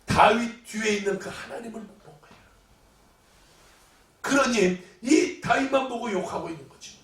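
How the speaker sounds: usual speaking voice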